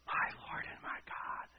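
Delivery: hushed, whispered gasp of awe. Acted. Thomas falling on his knees before Jesus, awed gasp. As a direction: gasp it out almost without voice. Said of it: "and just gasping".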